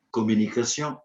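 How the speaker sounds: usual speaking voice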